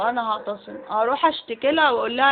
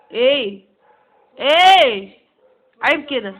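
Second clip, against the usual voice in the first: indignant shout; 10 dB louder, much slower than usual. Acted. Accused friend shouting back at the accuser, loud outrage. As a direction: bark it back in disbelief.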